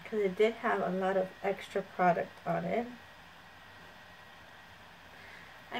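muffled through cloth; speaking while drying face; talking into a towel. Real, not acted. Seen 3: Towel pressed over mouth and nose throughout the words, drying face.

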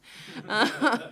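loud embarrassed laugh. Real, not acted. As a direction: burst out laughing. Laughing about her dancing.

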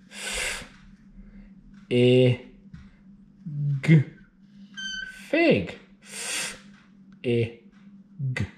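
segment F, I, g then Fig; sounding out words. Shown as a demonstration. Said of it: "let's write the f".

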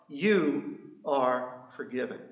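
soft and slow, a pastor reassuring; gentle reassurance. Retold or imitated. Pastor telling a new believer they are forgiven, softly reassuring. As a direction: let the words land gently.

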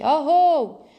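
high, loud drawn-out holler; calling back from afar. A narrator's reading. Friend answering from far off, calling loudly. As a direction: holler back over a long distance. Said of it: "чується десь далеко праворуч".

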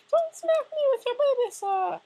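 very high squeal for the fleeing friend; squeaky mock panic. Friend running away, mocked in a squeaky high squeal. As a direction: shriek it like a tiny scared voice. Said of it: "And you running away like".

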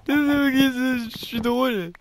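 voix contrefaite, aiguë, forte et traînante; étire les mots en te moquant de ta blague; autodérision criée. Pretend. Se moque de sa propre blague. (cri aigu d'autodérision)

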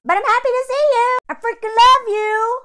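high, loud and fast, bursting with joy; loud, high happy gush at the audience; gushing excitement. Real, not acted. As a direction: squeal it like you've missed them. Greeting viewers with gushing enthusiasm.

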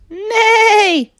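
very high, loud, long whinny; huge goose-as-horse whinny; mighty neigh. A narrator's reading. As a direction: neigh with all your might. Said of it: "with all her might".

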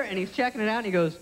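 ordinary talk

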